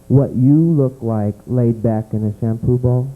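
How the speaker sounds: ordinary talk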